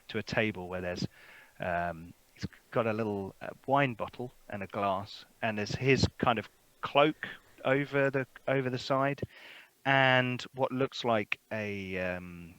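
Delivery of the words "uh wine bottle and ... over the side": ordinary talk, nothing marked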